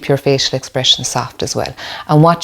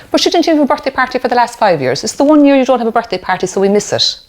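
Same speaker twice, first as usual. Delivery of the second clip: dismissive parent; 8 semitones higher, faster than usual. Retold or imitated. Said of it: "what do we say".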